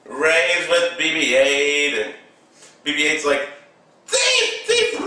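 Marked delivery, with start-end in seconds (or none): 4.1-5.0 very high, short mock squeals; squealing droid impression. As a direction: squeak it like an alarmed little robot. Voices the droid's cry.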